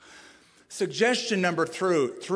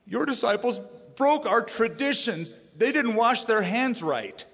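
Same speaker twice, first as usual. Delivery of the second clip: higher, petulant mock whine; whiny complaint; 7 semitones higher, 3 dB louder than usual. Pharisees tattling on disciples, mock whiny petulance. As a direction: tattle like a fussy child.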